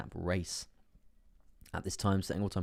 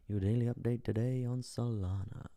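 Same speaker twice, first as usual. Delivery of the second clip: put-on voice; 4 semitones higher than usual. Streamer repeats his daily update to viewers, put-on voice. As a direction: say it as a playful put-on voice.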